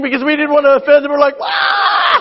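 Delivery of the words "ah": short, very high mock shriek; startled mock shriek; mock scream. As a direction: yelp like a bug just flew in. Bug fear scream.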